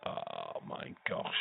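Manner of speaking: breathy shocked whisper. Real, not acted. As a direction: gasp it out in a whisper. Shocked at the blood.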